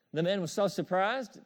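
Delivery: ordinary talk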